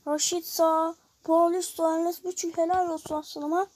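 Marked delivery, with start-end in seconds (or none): none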